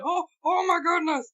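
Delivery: high, slow, breathless mock gasping; hyperventilating crowd. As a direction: ham up a crowd hyperventilating in shock. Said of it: "hyperventilating and shit".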